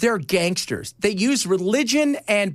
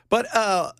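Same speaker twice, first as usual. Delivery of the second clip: ordinary talk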